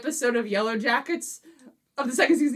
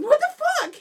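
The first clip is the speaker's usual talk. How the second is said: high, loud mock shock; a laughing cry of disbelief; shocked outburst; 3 dB louder, faster than usual. Fiance reacting to the spoiler, high shocked outburst. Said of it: "And she's like".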